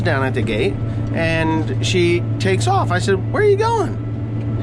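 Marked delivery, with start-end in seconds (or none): 3.3-4.6 high, slow, incredulous call; can't believe she's driving off; baffled. Retold or imitated. Wife drives past him.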